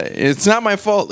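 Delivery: ordinary delivery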